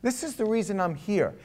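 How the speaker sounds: ordinary delivery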